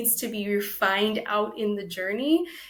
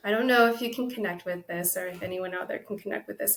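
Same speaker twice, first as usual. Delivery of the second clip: usual speaking voice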